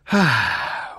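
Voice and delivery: loud, weary exhale; sigh. A narrator's reading. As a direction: let out an exasperated sigh.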